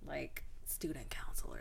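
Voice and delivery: unvoiced whisper aside. Real, not acted. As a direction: drop to a whisper.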